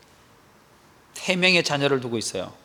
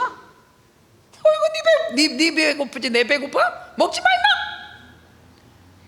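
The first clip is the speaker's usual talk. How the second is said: mocking laugh; 12 semitones higher, much slower than usual. Mother mocking her child's hunger strike.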